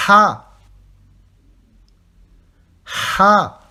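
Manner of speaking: Ha from the throat, loud with air. Shown as a demonstration. Teacher repeating Ha for students, air from throat. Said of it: "de l'air de votre gorge".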